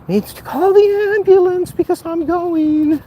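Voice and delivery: high, slow mock death throes; melodramatic dying. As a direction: play the dying hero, hammed up. Mock dying from climbing stairs.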